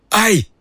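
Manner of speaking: sharp high cry of pain; pained yelp. Acted. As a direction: yelp as claws dig in. Cat claws Harry's hand.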